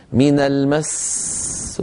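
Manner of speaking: من المس with a very long hissing s ending المس; stretched seen. Retold or imitated. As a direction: drag out the final seen of المس far too long. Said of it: "يمطون صوتهم في السين".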